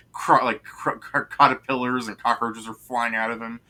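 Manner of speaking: ordinary talk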